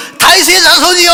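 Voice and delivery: high, loud desperate shout. Retold or imitated. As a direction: yell it out even harder. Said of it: "막 난리를 친".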